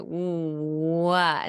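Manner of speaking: "What" with a long, drawn-out W; protruded-lip W glide. Shown as a demonstration. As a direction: push the lips out and glide slowly into "What". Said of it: "they're going to be protruded out".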